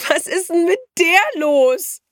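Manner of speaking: very high, loud, drawn-out jeer; shrieky, slow ridicule; spluttering with mirth. A narrator's reading. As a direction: burst out in giggly mockery. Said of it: "prustete Elisa".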